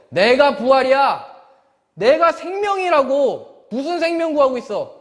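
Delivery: Jesus pressing the point on a listener who missed it; 높고 느린 목소리로 단호하게 일깨우는 예수님; Jesus' voice. Retold or imitated. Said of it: "얘기하는 거예요".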